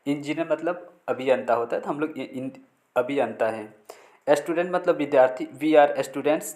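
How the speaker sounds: ordinary talk, nothing marked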